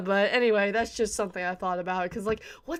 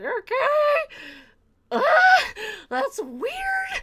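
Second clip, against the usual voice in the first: much higher, squeaky confused voice; high puzzled squeak; 14 semitones higher, 4 dB louder, much slower than usual. Retold or imitated. Fan puzzled by a strange voice, in a high squeak.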